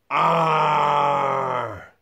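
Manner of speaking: loud shouted pirate arr. Pretend. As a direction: bellow it like a pirate. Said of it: "We gotta sound like pirates".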